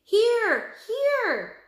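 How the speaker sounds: high, loud call. A narrator's reading. Annie calling the wildebeest to her. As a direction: call them over from a distance. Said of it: "she called".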